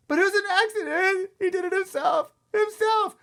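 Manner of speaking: very high, loud frantic wail. A narrator's reading. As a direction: wail excuses at full pitch. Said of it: "Sheila cried louder".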